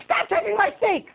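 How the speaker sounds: high-pitched squawky mock outburst; angry moviegoer. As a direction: act an irate woman in a high voice. Signs off as angry woman.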